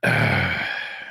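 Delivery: low, drawn-out sigh; heavy sigh. Real, not acted. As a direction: breathe out slowly, weighed down. Still heavy after grieving.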